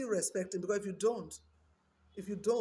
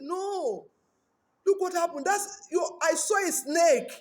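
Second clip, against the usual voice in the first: high, loud, urgent warning; alarmed pastor; 9 semitones higher, 7 dB louder, slower than usual. Retold or imitated. Pastor warning the wife about the snake, high and loud. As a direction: insist loudly he saw the snake.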